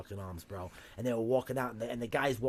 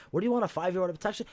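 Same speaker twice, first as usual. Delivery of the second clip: quick, loud, higher clerk voice; pushy clerk; 7 semitones higher, 7 dB louder, much faster than usual. Acted. Storyteller mimics the clerk pushing a protection order, fast and loud. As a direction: fire it off like a brusque clerk. Acting the court clerk's question.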